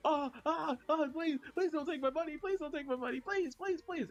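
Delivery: high-pitched, hammed-up begging; mock pleading victim. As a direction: beg like a panicked victim, overdone. Plays a pleading robbery victim.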